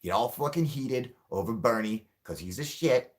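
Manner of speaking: normal talking voice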